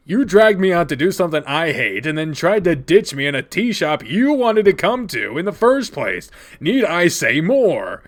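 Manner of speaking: loud, emphatic grievance, slowing at the end; indignant tirade. Acted. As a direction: lay out the grievance and drive it home. Angry tirade at friends.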